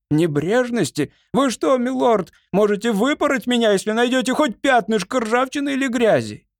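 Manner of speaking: high-pitched, affronted servant's voice; indignant servant. A narrator's reading. Ямбард defends his upkeep to the lord, indignant. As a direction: protest in a high, hurt rush to your master.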